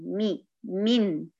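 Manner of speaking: "مي" then "من" said very slowly, meem clearly with kasra. Shown as a demonstration. Teacher correcting the common sukun on meem. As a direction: stretch "مي" to show the kasra. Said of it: "لما أجي أقرأها أقول مي".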